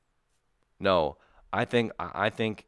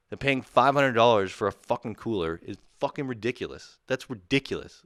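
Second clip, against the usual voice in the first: usual speaking voice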